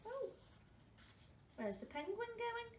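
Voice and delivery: plain reading voice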